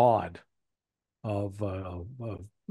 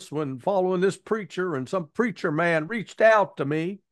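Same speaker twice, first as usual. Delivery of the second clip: mocking hillbilly accent, pitched much higher; 8 semitones higher than usual. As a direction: play a dim hillbilly recruit in a put-on hillbilly accent. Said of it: "Sorry for the hillbilly accent".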